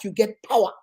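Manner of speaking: ordinary delivery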